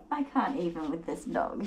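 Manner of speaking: ordinary delivery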